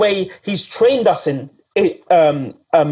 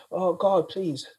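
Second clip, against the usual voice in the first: mock pleading; 13 dB quieter than usual. Preacher voices a fearful plea.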